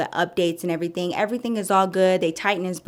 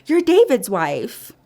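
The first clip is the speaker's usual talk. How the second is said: high, loud, drawn-out fake greeting; mock-sweet receptionist; 9 semitones higher, 5 dB louder, much slower than usual. Receptionists sizing up the wife, fake sweet. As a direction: mimic a judgy girl's high-pitched hello. Front-desk girls' awkward greeting mimicked.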